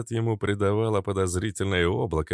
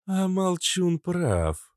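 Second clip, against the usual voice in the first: female character voice; 11 semitones higher, much slower than usual. Acted. Kobra agrees.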